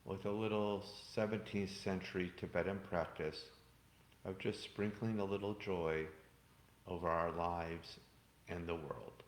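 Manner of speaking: soft, calm meditation voice; guided meditation. Acted. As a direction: gently close the meditation.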